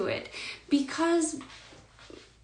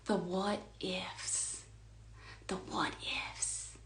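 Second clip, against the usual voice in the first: whispered; 3 semitones lower, 13 dB quieter, slower than usual. Acted. Repeating phrase as hushed mantra.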